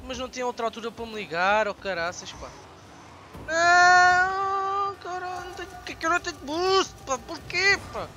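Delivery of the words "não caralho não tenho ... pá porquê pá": high, fast frustrated complaint; exasperated whine. Real, not acted. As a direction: whine at the game in disbelief. Angry at missing boost.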